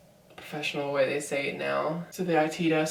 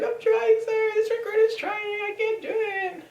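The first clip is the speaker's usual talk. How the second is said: very high, loud squealed protest; desperate recruit; 5 dB louder than usual. Retold or imitated. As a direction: act out an overwhelmed recruit in a squeaky, raised voice. Acted recruit pleading with drill instructors.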